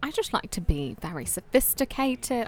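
refined mock British; overall British accent. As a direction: sound sophisticated in put-on British. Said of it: "overall British".

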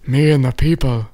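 a badly faked chief voice; Indian chief voice. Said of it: "that was a bad Indian chief".

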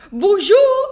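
high, loud mock-French Bonjour; playful French greeting. Stranger greeting the French bulldog, high loud mock-French. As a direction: chirp it like a cheeky stranger. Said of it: "And he goes".

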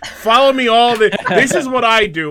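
loud, high-pitched shameless self-promoter; brash American guest. Acted. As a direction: plug yourself loudly like an overeager guest. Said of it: "The Americans are like".

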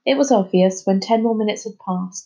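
usual speaking voice

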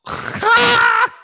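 failed attempt at a loud, high Predator screech; Predator sound. Shown as a demonstration. Showing listeners his Predator sound, loud botched screech. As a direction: screech like the Predator, dry-throated. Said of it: "I can do the Predator sound".

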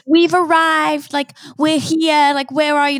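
slow, high singsong imitation; mocking group texters. Host ridicules friends' arrival messages in group texts, mocking. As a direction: draw it out in a high mock voice.

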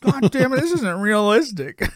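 high, loud, drawn-out fake complaint; hammed-up indignation breaking into laughter; mock outrage. Nitpicking a sitcom to the co-host, mock outrage.

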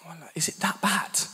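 hushed, breathy question to his wife; whispered worry. Retold or imitated. Husband whispering to smirking wife during rehearsal, hushed worry. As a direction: whisper it nervously mid-talk. Asking wife mid run-through.

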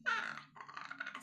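creaky vocal fry demo. Shown as a demonstration. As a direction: rattle out a creaky fry sound. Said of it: "you have to add the creak or the vocal fry".

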